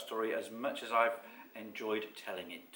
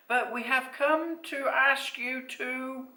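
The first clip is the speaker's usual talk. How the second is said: high, slow little creature; tiny, high-pitched storybook voice; small voice; 14 semitones higher, 5 dB louder, slower than usual. A narrator's reading. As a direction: make a timid request, drawn out. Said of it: "in a small voice".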